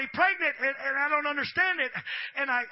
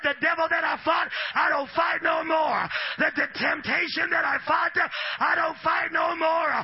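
shouted testimony; 5 semitones higher, 7 dB louder than usual. Retold or imitated. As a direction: shout it like you are set free. Acting a freed believer's testimony.

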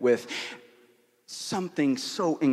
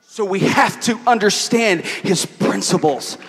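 emphatic, projected sermon delivery; preaching; 6 semitones higher, 8 dB louder than usual. Real, not acted. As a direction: preach it to the room, driving each point home. Preaches at full force.

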